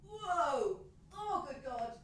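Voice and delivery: usual speaking voice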